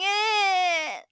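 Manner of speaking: short fake crying wail; hammed-up cry sound; mock sob. Mocking Laura as a victim, with a fake sob.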